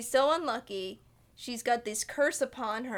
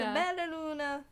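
put-on old Italian man; 6 semitones higher than usual. Friend imitating the grandpa's Italian about the moon. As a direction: croon it like a sweet Italian grandpa. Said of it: "sweetest little old Italian man".

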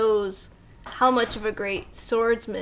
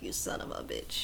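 whispered film line; quietly quoting Inigo to his killer; hushed quote; 12 dB quieter than usual. Retold or imitated. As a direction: breathe the revenge line softly.

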